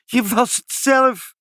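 very high, drawn-out exclamation; squealed accusation, stretched out; gleeful cry. A narrator's reading. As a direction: crow it in amused disbelief. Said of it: "riepen ze".

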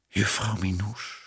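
soft, inward murmur; hushed, pensive musing; wistful thought. A narrator's reading. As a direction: think it quietly to yourself. Sad private thought about missing her.

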